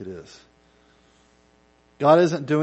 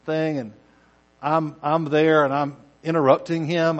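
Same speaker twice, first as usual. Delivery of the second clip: ordinary delivery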